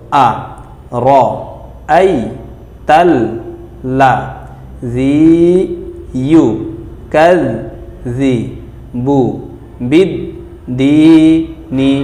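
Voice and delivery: each syllable apart, with pauses; broken syllables. Shown as a demonstration. Teacher to class, syllable by syllable. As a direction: spell it out syllable by syllable. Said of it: "আমরা ভাঙা ভাঙা উচ্চারণ করব".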